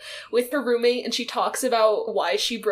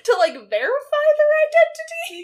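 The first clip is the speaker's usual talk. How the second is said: exasperated high; 6 dB louder than usual. Pretend.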